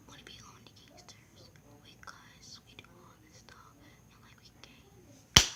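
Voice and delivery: quick secret whisper; conspiratorial whisper. Retold or imitated. Girl secretly inviting her to join the gangsters, in a fast whisper.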